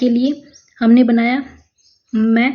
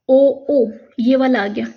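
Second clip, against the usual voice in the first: usual speaking voice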